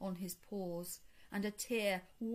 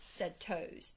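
usual speaking voice

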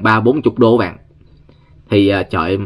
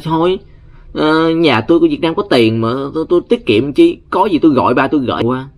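higher mock-snobby girl voice, tossing the words off; spoiled rich girl; 6 semitones higher than usual. Storyteller mimics spoiled student to coworkers, higher mock-snobby voice. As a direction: play the spoiled student bragging about daddy's money.

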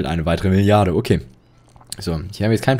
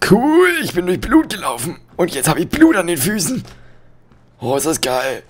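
silly high voice; 10 semitones higher, 3 dB louder than usual. Pretend. Goofy voice after stepping in blood.

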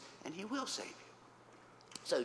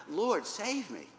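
higher mock cry for rescue; 6 semitones higher, 4 dB louder, slower than usual. Peter starting to sink, crying out. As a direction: cry out for help, lightly acted. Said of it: "and he cries out".

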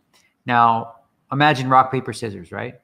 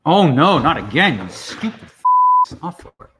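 frustrated; 5 semitones higher, 6 dB louder than usual. Real, not acted. Software freezes again.

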